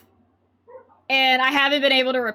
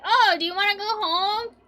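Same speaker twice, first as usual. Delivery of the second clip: friend coaxing; 7 semitones higher than usual. Retold or imitated. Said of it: "then you're like".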